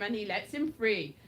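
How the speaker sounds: ordinary talk, nothing marked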